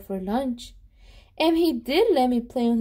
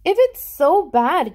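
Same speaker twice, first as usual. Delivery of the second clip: louder, higher, slow doubting; skeptical question; 5 semitones higher, 7 dB louder than usual. Acted. Friend Jeremy questioning the boy's warning, slow and doubtful. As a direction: challenge the claim slowly. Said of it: "Jeremy asked".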